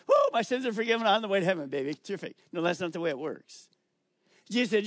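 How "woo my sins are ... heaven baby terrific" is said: mock-cheering fast and loud as a careless believer; preacher mocks easy believers with fast carefree cheer. Careless believer's quick, loud mock cheer. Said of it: "lots of people just want to have Jesus as a Savior".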